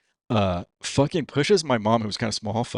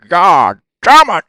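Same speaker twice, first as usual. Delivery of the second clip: drawn-out loud curse, higher; slow angry roar; 7 semitones higher, 12 dB louder, much slower than usual. Retold or imitated. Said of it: "he's all like".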